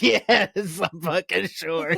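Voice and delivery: high, slow mock exasperated yes; a hammed-up drawn-out emphatic yes; exasperated insistence. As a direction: lean on it with amused annoyance. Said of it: "And I was like".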